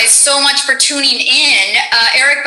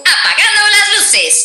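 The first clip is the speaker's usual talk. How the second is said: much higher game-character line in Spanish; playful Spanish voice line, pitched up; Sombra; 11 semitones higher than usual. Acted. Host rewards a subscriber with a Sombra line, high character voice. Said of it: "You get a Sombra voice line".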